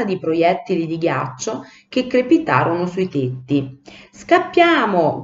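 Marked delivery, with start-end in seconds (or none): 4.1-5.2 high, drawn-out cry to flee. A narrator's reading. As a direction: call the alarm, stretching the word. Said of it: "gridò Cipì". (grido d'allarme, acuto e prolungato)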